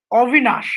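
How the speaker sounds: "Avinash" opening on a rounded "aw" vowel; Bengali pronunciation. Shown as a demonstration. Teacher showing how Bengal says the name, Bengali "Avinash". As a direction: say "Avinash" as "Aw-vinash", keeping the final sh. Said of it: "if you go to Bengal, there will be people".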